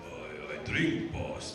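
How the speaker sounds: low, slow, giant's rumble; an old friend lightly imitating a giant's answer; Andre. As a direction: rumble it out slowly like a gentle giant. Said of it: "And Andre said".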